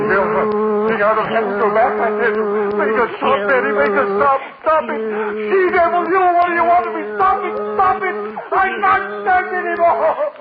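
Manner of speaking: a tormented man begging the she-devil to stop; hysterical terror. Acted. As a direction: scream at the voice to stop.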